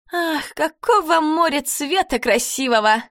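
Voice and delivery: high, dreamy mock sigh. A narrator's reading. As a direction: gush about the sea mockingly. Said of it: "вздохнула Люперца".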